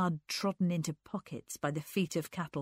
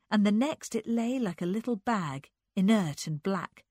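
plain reading voice

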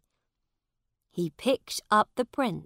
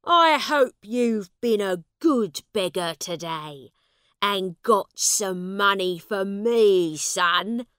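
gruff demanding father; 2 semitones higher, 9 dB louder than usual. Acted. Angry father demands money.

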